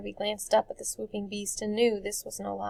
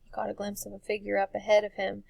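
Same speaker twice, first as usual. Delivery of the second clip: ordinary delivery, nothing marked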